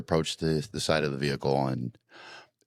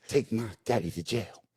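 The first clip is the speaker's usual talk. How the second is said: put-on quiet kid repeat; 4 semitones higher, 6 dB quieter than usual. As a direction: repeat it softer like a child. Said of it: "just keeps hammering this out".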